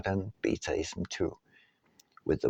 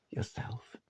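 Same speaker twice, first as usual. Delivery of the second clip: whispered; 12 dB quieter than usual. Real, not acted. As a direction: drop to a breathy whisper on the last word. Soft closing word of sentence.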